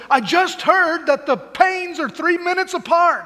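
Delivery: high, excited British newsman imitation; British accent. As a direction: put on an eager British reporter's accent. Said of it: "in his British accent".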